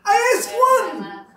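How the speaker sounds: high, loud ranting outrage; the father screams at the doctor about another girl; screaming complaint. Retold or imitated. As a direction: yell it at the doctor. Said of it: "why are you screaming?".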